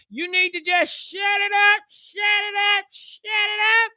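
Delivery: rising, slowing order to shut up. Real, not acted. Host telling internet critics to hush, rising scold.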